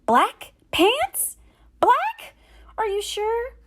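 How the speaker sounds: high, loud shocked cry; gasping disbelief. Acted. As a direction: squeal in horror at the news. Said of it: "Jameson gasped".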